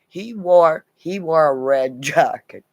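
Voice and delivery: normal talking voice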